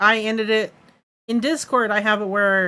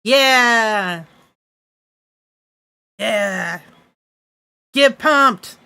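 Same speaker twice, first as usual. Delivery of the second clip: energy drink ad; 3 semitones higher, 7 dB louder, much slower than usual. Pretend. Channel point redeem, hype shouts.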